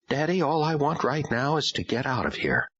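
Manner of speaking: a hint of a weary girl wanting out; daughter voice. A narrator's reading.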